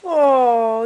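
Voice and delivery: usual speaking voice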